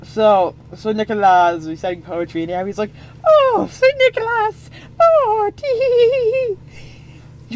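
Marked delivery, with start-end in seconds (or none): 3.2-7.5 very high, slow giggly swoon; swooning girl. Acted. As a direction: squeal like a smitten lady. Abby swoons over poetry.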